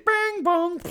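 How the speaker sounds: ding the doors shut; mock chime then thud; imitated door chime. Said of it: "the doors are like".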